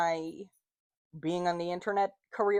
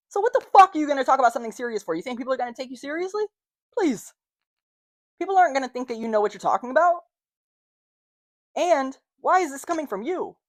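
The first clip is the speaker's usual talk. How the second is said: fast, higher, scornful put-down; mocking critics; 6 semitones higher, 4 dB louder, faster than usual. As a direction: rattle off a sneering dismissal of her. Imagined critics mocking her.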